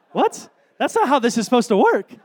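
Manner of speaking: high, loud incredulous protest; indignant disbelief. Retold or imitated. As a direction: protest to God in comic outrage. Retold indignant reply to God.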